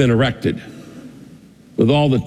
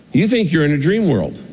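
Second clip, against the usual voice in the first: normal talking voice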